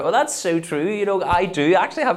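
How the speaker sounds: high, loud posh voice with a laugh; posh fan voice, high and loud, laughing. Retold or imitated. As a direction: play a posh person proudly agreeing. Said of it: "people that are like".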